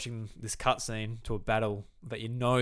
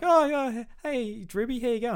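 high, sing-song fake friendliness; mock friendly villain; 12 semitones higher, 5 dB louder than usual. Player mocks a suspiciously friendly villain, high fake cheer. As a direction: play the too-nice villain greeting you.

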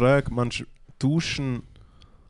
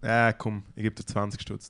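usual speaking voice

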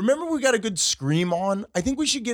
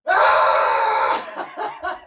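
scream for the camera; 12 semitones higher than usual. Real, not acted. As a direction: push a quick scream out. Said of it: "I'm gonna do this for the camera".

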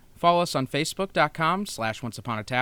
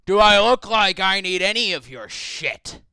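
loud, higher-pitched indignant demand; angry outburst; 6 semitones higher, 9 dB louder than usual. Acted. Dwarf standing on his chair at nosy visitors, angry outburst. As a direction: throw it at them, loud and affronted. Angered dwarf snaps at party.

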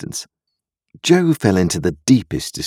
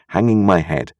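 ordinary delivery, nothing marked

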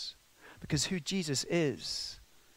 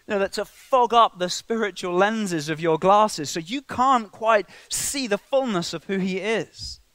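louder, higher passionate sermon; preaching; 6 semitones higher, 7 dB louder than usual. Acted. Preacher urging the congregation about spiritual blindness, fervent. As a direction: drive the point home to the church.

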